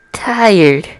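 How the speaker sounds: ordinary delivery, nothing marked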